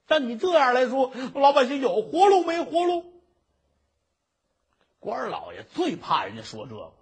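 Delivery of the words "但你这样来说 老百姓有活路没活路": loud protest. Acted. Merchant challenges the judge.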